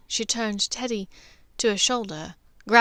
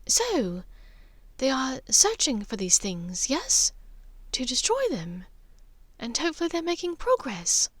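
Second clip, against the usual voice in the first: a gentle, higher mother's voice, sad and wondering; 5 semitones higher than usual. Acted. Narcissa voiced by narrator.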